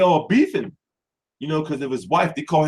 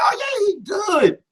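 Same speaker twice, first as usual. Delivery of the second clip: relieved cheer; 13 semitones higher than usual. Retold or imitated. Fan relieved.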